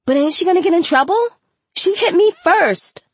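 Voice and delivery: high, fast indignant outburst; shrill schoolgirl yelling; screaming. A narrator's reading. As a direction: protest with rising outrage. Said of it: "Shayla screamed".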